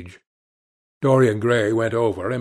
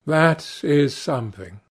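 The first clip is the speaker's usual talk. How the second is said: plain reading voice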